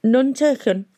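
as if spoken from under the floor; muffled voice. Acted. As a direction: voice muffled from below the seat. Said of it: "said Beavis's muffled voice from somewhere below".

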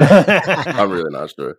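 embarrassed laugh through the words. Real, not acted. Laughing off not knowing.

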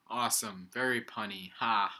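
ordinary delivery

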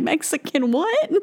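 high-pitched puzzled echo; mock bafflement. Restaurant staff baffled by "Mexican restaurant", mock bafflement. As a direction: play utterly baffled by the word.

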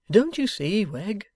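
high, plaintive appeal; meek old man pleading; feeling plea. A narrator's reading. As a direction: implore him with feeling. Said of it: "Mister Boffin feelingly represented to him".

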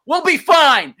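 loud, high declaration; retold bravado. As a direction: belt it out with mock confidence. Mock confidence after losing his act.

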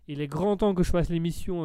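usual speaking voice

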